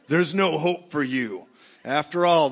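loud, slow pronouncement of doom; condemning religion. Acted. Religion telling churchgoers they are lost, loudly condemning. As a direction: play a condemning judge, hammering it out. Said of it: "religion tells you".

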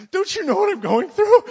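high, loud, put-on whiny voice; mock whine. Husband whining to his wife Heather for sympathy, high mock whine. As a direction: ham up a self-pitying husband.

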